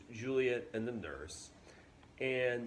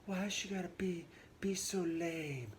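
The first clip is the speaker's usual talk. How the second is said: whiny Juliet; 9 semitones higher, 3 dB quieter than usual. Retold or imitated. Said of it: "But she's like".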